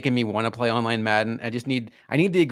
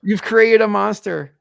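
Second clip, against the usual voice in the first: mock dramatic; 9 semitones higher, 6 dB louder, slower than usual.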